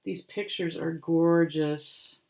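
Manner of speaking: usual speaking voice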